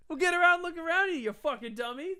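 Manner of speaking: high-pitched mock heckle; heckling old man. Acted old man insulting him in story.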